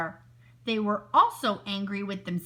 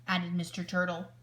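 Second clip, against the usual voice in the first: ordinary delivery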